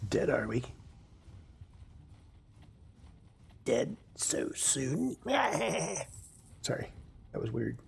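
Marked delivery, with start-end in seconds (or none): 0.1-5.2 slow, sinister villain taunt; crazy villain voice. Acted. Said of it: "My crazy villain voice". 5.2-6.2 high gleeful cackle; evil villain laugh. Acted. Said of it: "my villain voice".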